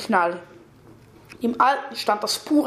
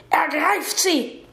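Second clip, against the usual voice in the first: slow, high-pitched command; commanding order; 5 semitones higher, slower than usual. A narrator's reading. Young man sets monsters on the girl, drawn-out command. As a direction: call out the order, drawn out and raised.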